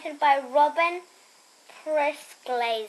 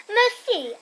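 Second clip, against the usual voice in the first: bright thanks; 6 semitones higher, 4 dB louder than usual. A narrator's reading. Nancy says thank you in French.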